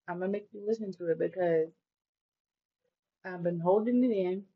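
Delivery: talking around a peppermint; words round a mint in the mouth. Real, not acted. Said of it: "I have a peppermint in my mouth".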